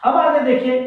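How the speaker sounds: ordinary delivery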